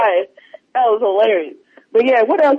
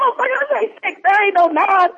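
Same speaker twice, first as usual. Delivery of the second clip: mock yell; 9 semitones higher, faster than usual. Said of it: "They was like".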